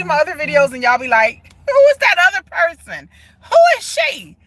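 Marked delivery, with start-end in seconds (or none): 1.7-4.4 high, loud viewers' disbelief; mock incredulous. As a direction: act out shocked viewers demanding an answer. Voices viewers baffled by her look.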